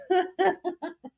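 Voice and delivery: high giggling laugh. Real, not acted. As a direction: giggle helplessly at your own joke. Laughs after imagined send-off.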